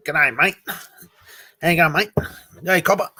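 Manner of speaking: loud, cheery Australian accent, lines rising at the ends; Aussie slang. Shown as a demonstration. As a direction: ham up a broad Australian bloke. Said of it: "Say in an Aussie slang".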